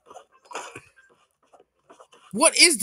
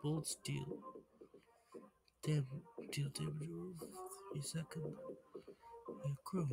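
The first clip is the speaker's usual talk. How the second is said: ordinary talk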